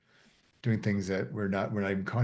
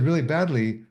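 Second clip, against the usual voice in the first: bad news said brightly, higher and louder; upbeat tone; 5 semitones higher, 5 dB louder than usual. Shown as a demonstration. Student answering a greeting as an experiment, upbeat tone. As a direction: chirp it as if all were great. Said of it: "with a really sort of upbeat tone of voice".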